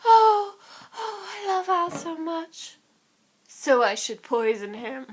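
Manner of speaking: high, slow lovestruck sigh; lovesick schemer. Retold or imitated. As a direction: swoon then calmly plot murder. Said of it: "I just think of her like".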